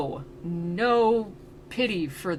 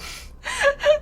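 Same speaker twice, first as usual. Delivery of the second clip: a sudden high burst of laughter; real laugh. Real, not acted. Laughing at bridal carry.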